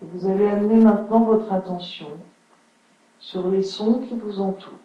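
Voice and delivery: unhurried yoga nidra guidance with long pauses; guided relaxation. Acted. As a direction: settle listeners into stillness, phrase by phrase. (voix posée de relaxation guidée)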